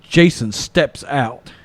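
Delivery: normal talking voice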